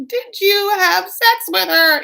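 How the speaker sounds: high, loud, slow mock wail; very upset. Wife accusing Michael of cheating, mock upset wail. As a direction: drag out the accusation in a put-on shriek. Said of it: "like, very upset".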